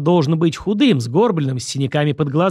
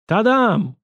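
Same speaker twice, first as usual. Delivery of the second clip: higher, drawn-out ta-da flourish; triumphant; 8 semitones higher, 3 dB louder than usual. A narrator's reading. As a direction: announce it like a magician's reveal. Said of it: "произнёс я торжественно". (торжественно)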